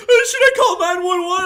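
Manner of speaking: mock panicked high yell; imitation of a panicked relative; freaking out. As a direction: blurt it high and loud, imitating someone in a panic. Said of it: "Trent's freaking out".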